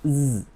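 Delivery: জ alone as a long "zzz"; voiced z sound. Shown as a demonstration. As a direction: buzz জ with no vowel after it. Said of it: "এস এর sound মাঝে মাঝে".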